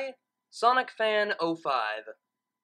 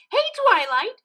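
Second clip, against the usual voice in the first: high, loud Pinkie greeting; cheerful shout; 9 dB louder than usual. Acted. Pinkie surprises Twilight from nowhere with a loud high shout. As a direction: pop out and call her name. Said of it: "Pinkie shouted".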